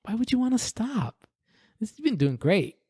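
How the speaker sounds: high, coaxing dealer voice; tempting dealer. Retold or imitated. Casino dealer urging the gambler on, high and coaxing. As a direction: lure him to keep betting. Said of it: "the dealer behind the table was like".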